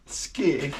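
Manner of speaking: skeer said as the Dutch word, rolled r. Shown as a demonstration. Vlogger describing a cheap flyer, says skeer in Dutch. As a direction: say skeer the Dutch way, short and crisp. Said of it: "as we would say it in Dutch".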